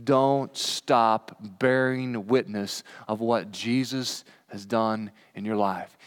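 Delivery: normal talking voice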